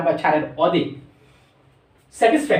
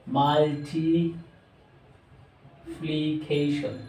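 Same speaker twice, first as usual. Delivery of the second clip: very slow, softer multiplication split into syllables; 5 dB quieter, much slower than usual. Shown as a demonstration. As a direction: break multiplication into its syllables, slowly. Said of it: "চারবার উচ্চারিত". (Multiplication খুব ধীরে নিচু স্বরে)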